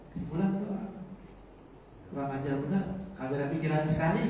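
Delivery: mock complaint about someone senseless; negative, scolding intonation. Shown as a demonstration. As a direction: say it as a reproach for thoughtlessness. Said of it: "kalau intonasinya beda".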